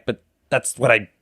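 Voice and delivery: ordinary delivery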